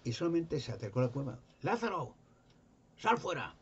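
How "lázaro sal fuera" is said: high, commanding call into the cave. Retold or imitated. Jesus calls dead friend from tomb, commanding high call. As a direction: summon the dead man out.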